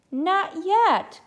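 high-pitched, lightly acted little-bear protest; protesting cry. A narrator's reading. Little bear to mama at bedtime, mildly crying out in protest. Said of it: "cried Little Brown Bear".

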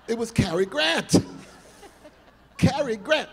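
laughing in delighted disbelief. Real, not acted. Telling the interviewer about Cary Grant, laughing loudly.

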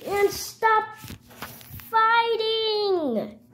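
high, drawn-out yell to stop; pleading cry. Pretend. Someone breaks up the play fight, high drawn-out cry. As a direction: cry out high, stretching the last word.

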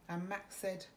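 usual speaking voice

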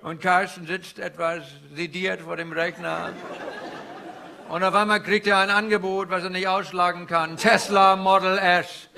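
put-on comic Indian-accented stand-up guru.

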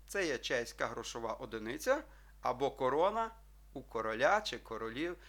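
normal talking voice

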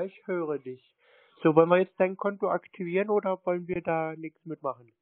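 usual speaking voice

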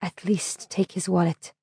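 soft, slow plea; pleading. A narrator's reading. The injured driver pleads with the rescuer, soft and slow. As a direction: beg quietly, worn out. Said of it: "Isserley pleaded".

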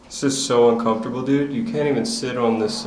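ordinary delivery

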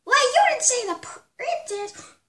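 high squeaky voice. Acted. Teammate squeaks a slow objection.

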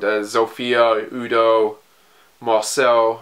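ordinary talk, nothing marked

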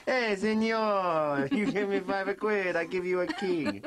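slow, hammed-up Italian accent. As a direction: drawl it like an Italian porter. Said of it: "I do a brilliant Italian accent".

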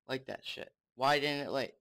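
usual speaking voice

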